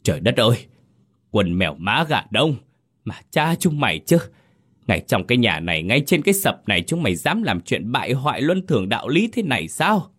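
high, furious screeching scold; outraged wife screaming her disbelief; shrieking outrage. Acted. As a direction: shriek at the cheating husband. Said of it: "Bà rít lên một tiếng kinh hoàng".

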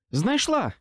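high triumphant call; shout. A narrator's reading. Susan shouts up from below, triumphant call. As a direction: call out the find. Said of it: "крикнула вона".

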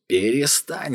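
high yell to make him stop. A narrator's reading. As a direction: cry out high, sharp. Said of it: "заорал Гуннар".

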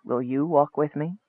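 low-key, hushed invitation; soft. A narrator's reading. Wizard asking Michael to walk with him, softly. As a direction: invite him quietly, well under full voice. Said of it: "said the wizard softly".

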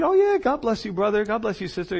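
high-pitched, put-on friendliness; mock peaceable greeting. Two-faced neighbour greeting brother and sister, mock friendly. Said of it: "One speaks peaceably to his neighbor with his mouth".

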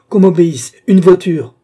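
high, loud, imperious order; commanding shout. Acted. Mistress demands a carriage from Marguerite, commanding shout.

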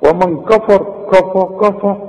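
«كفر» بكاف وفاء غليظتين; فخم فتحات «كفى» كنموذج للخطأ; تفخيم الكاف خطأ. Retold or imitated. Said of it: "دي تيجي دي". («كفى» مفخمة قريبة من «كوفو»)

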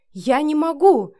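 high, loud, drawn-out desperation; desperate protest. A narrator's reading. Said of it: "запротестовала в отчаянии Вика". (протестуя в отчаянии)

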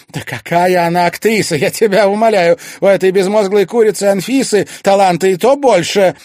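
loud, high, scornful outburst; heated scorn. A narrator's reading. Mother disparaging an actress to her son, heated scorn. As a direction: scoff at full voice.

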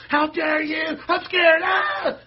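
mocking students who cry and shriek at the professor; mock hysterical student. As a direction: ham up a terrified crybaby shriek. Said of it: "And they go".

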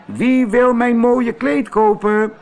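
high, loud calling out to buyers; loud market call. Acted. Antonio roept op de markt: luid en hoog omroepend. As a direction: hawk it across the market. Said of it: "riep hij zo hard hij kon".

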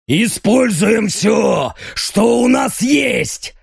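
slow, high barking shout. Acted. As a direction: bark out the battle cry. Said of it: "рявкает Эль Лобо".

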